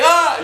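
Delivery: short, high, loud yelp of pain; sudden loud cry as the arm gets cranked; pained mock scream; acted scream. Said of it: "You scream".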